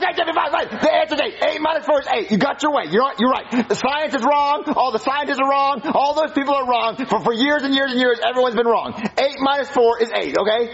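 high-pitched, flustered surrender; worked-up sarcastic concession; exasperated giving in. Acted. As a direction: cave in, voice climbing with frustration.

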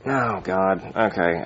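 usual speaking voice

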